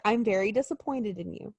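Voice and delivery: low, soft parental-style reproach; mock disappointment. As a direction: playact quiet, let-down disapproval. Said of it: "that person who's like".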